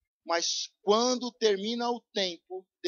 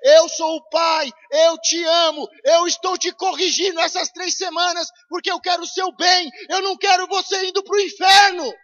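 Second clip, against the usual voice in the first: shouting as God; 7 semitones higher, 10 dB louder than usual. Acted. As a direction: thunder it as the Father. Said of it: "É Deus falando com cada um de nós".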